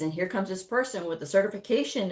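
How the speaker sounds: ordinary talk, nothing marked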